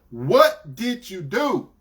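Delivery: slow, deliberate demand; dead serious. Retold or imitated. Boyfriend interrogating his girlfriend, slow and dead serious. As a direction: space out each word as you demand it. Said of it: "dead serious".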